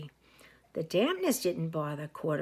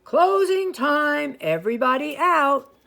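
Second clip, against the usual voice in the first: calling out; 7 semitones higher, 11 dB louder than usual. A narrator's reading. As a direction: bellow it across the room. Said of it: "the manager ... called".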